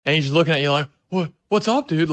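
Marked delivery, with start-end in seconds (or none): 1.1-2.1 imitating a jittery, high-pitched friend; higher, mildly sketched-out mock voice; retold sketchy greeting, pitched up. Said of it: "he's all fucking sketched out".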